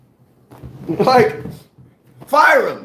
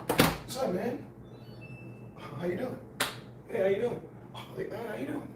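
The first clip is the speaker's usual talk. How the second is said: much softer, mumbled casual greetings; imitating Greg; 17 dB quieter than usual. Imitating Greg walking into the locker room, soft mumbled hellos. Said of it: "I'ma show y'all how Greg be walking".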